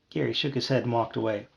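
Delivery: ordinary delivery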